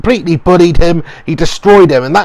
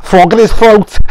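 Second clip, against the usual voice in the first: mock Scouse accent; 4 semitones higher than usual. Vlogger mocking a Liverpool fighter in a Scouse accent. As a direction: put on a thick Scouse voice to take the mickey. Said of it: "he talks like this".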